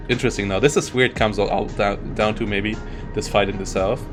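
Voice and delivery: normal talking voice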